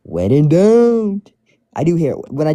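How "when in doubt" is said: put-on slow, drawn-out New York accent. Friend repeats Link's catchphrase in New York accent. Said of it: "this accent is actually famous".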